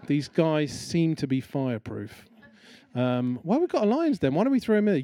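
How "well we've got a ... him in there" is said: higher, keen schemer's voice, pleased with the idea; eager schemer. Acted. Satraps scheming against Daniel, high and eager. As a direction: pitch it up like a sly plotter pitching a plan. Satrap suggests the lion's den.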